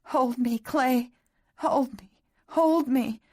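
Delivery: cling and beg to be held; desperate begging. A narrator's reading. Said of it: "she begged".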